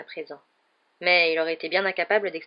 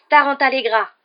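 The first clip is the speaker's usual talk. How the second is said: loud, higher-pitched spell cast; incantation lancée; 7 semitones higher, 10 dB louder than usual. A narrator's reading. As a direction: fling the spell out forcefully. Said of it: "parvint à articuler". (incantation criée avec force)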